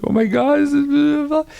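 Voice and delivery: replaying being moved to tears by the footage; overwhelmed gush. Composer retelling seeing the opening, high emotional gush.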